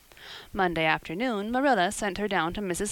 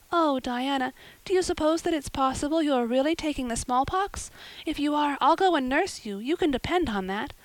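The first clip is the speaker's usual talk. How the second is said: put-on high, pleading girl's voice, anxious for her friend; a young girl coaxing her sick friend to stay, voice raised high; eager Anne; 7 semitones higher than usual.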